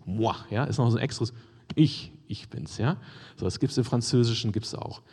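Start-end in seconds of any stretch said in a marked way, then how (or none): 1.8-2.8 ICH set apart slowly before ich bin's; emphatic doubled ich, slow and low; stress on ICH. Shown as a demonstration. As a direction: give ich its own slow beat, then repeat it. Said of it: "es ist eine extra Betonung".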